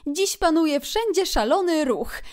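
high, animated otter voice; Otter, chatty. A narrator's reading. Otter reports the bustle on the river, lively. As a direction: share river gossip with friends.

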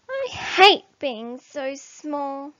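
a small creature's loud slow wail; lament. A narrator's reading. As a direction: whine out how unfair it is. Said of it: "he tells her".

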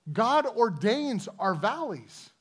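slow, weighted words, "God" stressed; slow emphatic repeat. Shown as a demonstration. Preacher repeating the point to his congregation, slow and weighty. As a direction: let each word land slowly. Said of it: "That God ordains our valleys".

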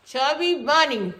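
slow, muffled by full cheeks; cheeks stuffed full. Real, not acted. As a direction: force it out round marshmallows. Stuffed mouth.

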